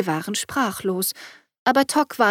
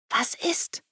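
whisper; 5 semitones higher, 7 dB quieter than usual. A narrator's reading. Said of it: "flüsterte Mats".

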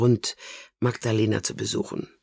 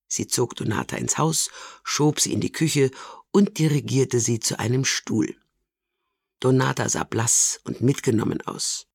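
ordinary delivery

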